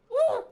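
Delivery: short high loud "woo" whoop; high gnome whoop; gnome noise. Shown as a demonstration. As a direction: imitate a cartoon gnome with one high-pitched hoot. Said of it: "my gnome noise when I saw it".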